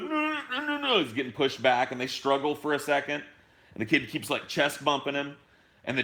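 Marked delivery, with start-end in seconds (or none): 0.0-1.0 put-on much higher, squeaky kid refusing; whiny kid. Retelling the kid who blocks the man, in a high whiny kid voice. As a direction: play the bratty kid whining no over and over.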